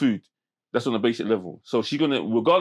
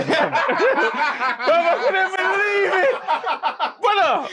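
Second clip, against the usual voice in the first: high, loud laughing shout; laughing disbelief; 5 dB louder, much slower than usual. Real, not acted. Laughing disbelief at jail story.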